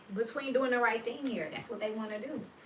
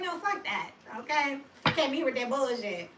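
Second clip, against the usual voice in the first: higher, louder fed-up retort; mock angry reply; 4 semitones higher, 5 dB louder than usual. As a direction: push back on the moody coworker, fed up. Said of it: "And it's like".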